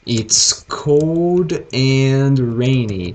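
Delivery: slow, careful "It's cold and rainy" with each word drawn out; teacher models "It's cold and rainy" slowly, stressing "cold"; English model phrase. Shown as a demonstration. Teacher shows students how to describe weather, slow and clear. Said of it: "você falaria assim".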